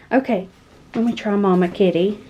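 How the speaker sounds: ordinary talk, nothing marked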